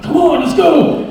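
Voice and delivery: slow, high, loud mock yell; impatient driver. Truck driver behind him, a slow loud mock yell. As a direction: hammer the impatient honk of a driver. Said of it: "this guy's behind me".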